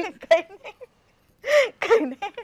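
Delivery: slow, giggling "nothing"; laughing it off. Real, not acted. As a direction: brush it off while laughing. She laughs at being called mataji.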